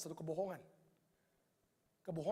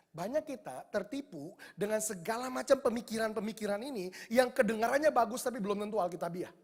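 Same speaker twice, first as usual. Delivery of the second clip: usual speaking voice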